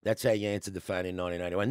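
ordinary talk, nothing marked

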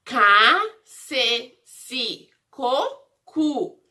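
"Ca, ce, si, co, cu" as separate recited syllables; syllable drill. Shown as a demonstration. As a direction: recite "Ca, ce, si, co, cu" with a beat between each. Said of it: "Lembra da sílaba C?".

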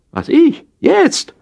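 shocked that he's asked now; surprised. Acted. Startled squeak when his friend on the phone asks him over.

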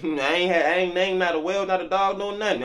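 high, loud, fast indignant protest; mock outrage. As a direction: throw up your hands, I named nothing. Mock outrage as Adam not naming.

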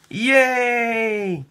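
high delighted cheer; joyful yay. Acted. Peter waking to new snow, cheering high. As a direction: cheer like a child at fresh snow.

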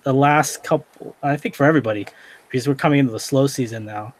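ordinary talk

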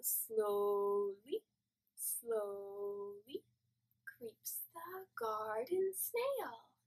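very soft and slow, creeping like a snail; hushed slow singsong; gentle creeping whisper-song; slow soft snail verse. Shown as a demonstration. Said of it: "let's try it again".

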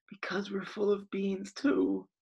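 whisper. Acted. Fearful confession.

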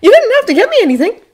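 put-on high, loud grateful kid; surprised. A narrator's reading. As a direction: thank them high, pleasantly taken aback.

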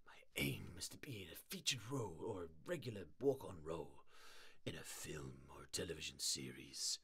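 soft secret whisper; whispered aside. Real, not acted. Streamer confiding her dream to chat, hushed whisper. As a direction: let the viewers in on a secret.

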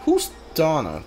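ordinary talk

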